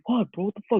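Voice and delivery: high-pitched startled questions; alarmed reply. Retold or imitated. Answering his friend's sudden phone call, alarmed. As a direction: act out being thrown by a frantic call.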